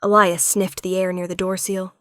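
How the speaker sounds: plain reading voice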